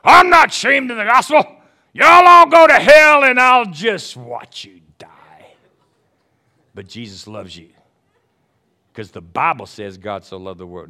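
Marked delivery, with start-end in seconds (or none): 0.0-5.5 shouted, high mocking zealot voice; hammed-up arrogant shout fading to a hiss; arrogant preacher mockery. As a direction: sneer it like a hellfire street preacher. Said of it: "I've had people arrogantly say that".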